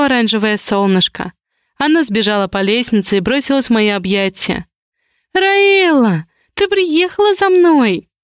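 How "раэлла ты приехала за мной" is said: little sister running to her big sister, high joyful shout; joyful child shout. A narrator's reading. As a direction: squeal like a happy little sister. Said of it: "закричала радостная сестрёнка".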